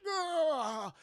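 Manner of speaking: high groan sliding downward; mock agonized groan; wounded groan. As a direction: groan as if gashed in the belly.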